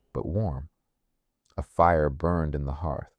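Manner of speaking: ordinary delivery, nothing marked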